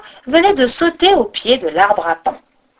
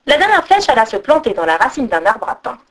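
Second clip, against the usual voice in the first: ordinary delivery, nothing marked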